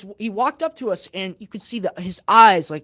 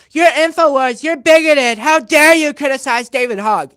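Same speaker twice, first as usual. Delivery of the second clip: loud, higher imitation of an outraged heckler; mock indignant accusation; 5 semitones higher, 10 dB louder than usual. Imitating the heckler's accusations, quoted exactly.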